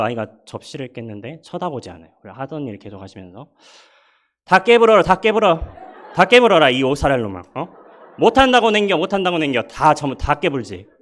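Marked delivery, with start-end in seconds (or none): 4.4-10.9 high, loud mock scolding in Jeolla dialect; Jeolla mom yelling. A Jeolla mother yelling at her child over a broken plate, high and loud. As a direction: yell at the kid like a fed-up Jeolla mother. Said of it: "그래가지고 이렇게 하신대요".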